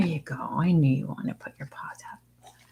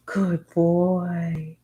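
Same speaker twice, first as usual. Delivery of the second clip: ordinary delivery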